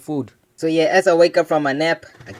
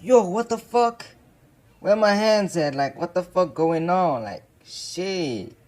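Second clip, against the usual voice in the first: high, slow freaked-out disbelief; panicked; 4 semitones higher, slower than usual. Retold or imitated. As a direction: sound shocked and scared. Discovering he has no hands.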